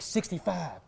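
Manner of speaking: hushed repeated echo of a sentence; echoing in my head. Retold or imitated. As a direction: let it ring like an echo chamber. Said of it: "like being in an echo chamber".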